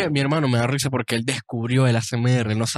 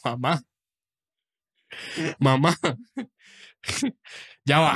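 laughing protest. Retold or imitated.

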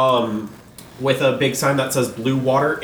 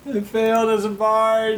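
mock lament; 8 semitones higher than usual.

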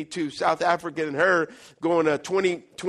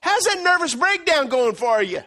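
much higher, louder bright hail; cheery teasing pastor; 8 semitones higher, 5 dB louder, slower than usual. Retold or imitated. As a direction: call out playfully across the hall. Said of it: "and he goes".

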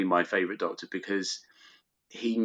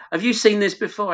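surprised friends; 12 semitones higher, 7 dB louder than usual. Retold or imitated. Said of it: "they said".

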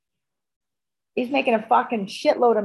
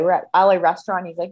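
ordinary delivery

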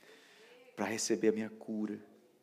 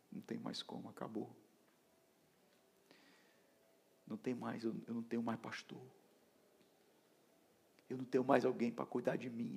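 soft, resigned retold confession; dejected; 9 dB quieter than usual. Telling the apostle he has no pastor, hushed despair. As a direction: drop right down, as if all is lost.

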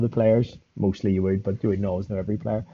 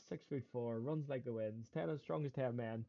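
much softer, quicker run through the list; manager recapped; 19 dB quieter than usual. Retold or imitated. Player recapping manager's report, lightly imitated. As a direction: toss off the manager's list in summary. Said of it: "the manager went, blah, blah, blah".

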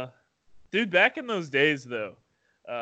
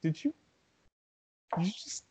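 stunned quiet; 2 semitones higher, 9 dB quieter than usual. Retold or imitated. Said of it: "I was like".

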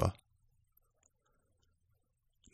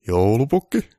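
a hint of a stern caller, louder; 4 semitones higher, 7 dB louder, slower than usual. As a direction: call his name sharply. Boss calling Santa.

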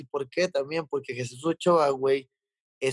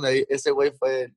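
ordinary delivery

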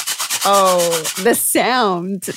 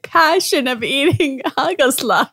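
laughing; 4 semitones higher than usual. Real, not acted. Teasing while laughing.

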